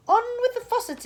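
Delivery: high, loud shout from afar. Acted. Cloud man shouting orders from above, high and loud. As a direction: bellow down from the cloud. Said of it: "it shouted".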